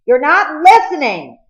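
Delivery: high, loud desperate scream. Acted. Cole screaming after the departing boat. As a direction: scream it across the water. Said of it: "Cole screamed across the water".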